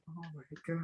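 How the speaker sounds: hushed, mortified mock gasp; imitated embarrassment. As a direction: barely voice it, as if caught out. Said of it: "yeah, embarrassed".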